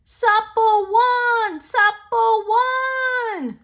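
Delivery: high, loud, slow victory cry; shouting. Acted. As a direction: celebrate the win. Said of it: "shouted the jungle animals".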